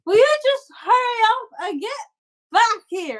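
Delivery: put-on high, exasperated child's yell; impatient young boy. Boy orders crying girl back, high and impatient. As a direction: order her back like a fed-up kid. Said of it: "And he goes".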